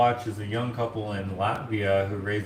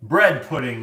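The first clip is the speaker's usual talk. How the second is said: loud, high fairground announcement; jester crier; 7 semitones higher, 8 dB louder, slower than usual. Acted. Jester announcing food at a Renaissance fair, loud proclamation. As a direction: hawk it slowly like a town crier. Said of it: "I always think of a jester".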